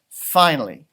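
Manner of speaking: plain reading voice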